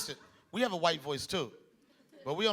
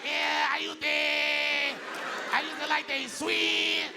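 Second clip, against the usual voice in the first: Puerto Rican guy impression; 8 semitones higher, much slower than usual. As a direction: shout it like a Puerto Rican dude, drawn out. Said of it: "He was like".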